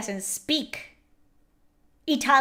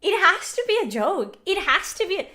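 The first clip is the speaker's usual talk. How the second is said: high, louder disbelief; can't believe it; high incredulous; 7 semitones higher, 3 dB louder than usual. Real, not acted. Disbelief at game.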